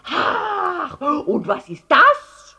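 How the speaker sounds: hoher, lauter, gedehnter Aufschrei; schreiend. A narrator's reading. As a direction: triumphierend anklagend schreien. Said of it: "schrie Herr Letscher". (empörtes Geschrei)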